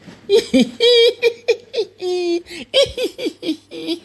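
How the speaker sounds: put-on high, far-off girlish cackle; high mocking giggle. Reader giggling as the three mocking friends. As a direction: giggle like a cheeky schoolgirl. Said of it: "elles ont ri".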